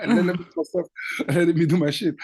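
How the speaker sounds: laughing through a cocky brush-off, pitch up; amused mock-dismissive retort. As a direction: toss it off while laughing at yourself. Retold brushing off organizer, laughing.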